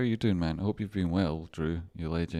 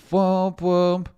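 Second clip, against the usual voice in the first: mock womp womp; 12 semitones higher, 8 dB louder than usual. Mock sad trombone.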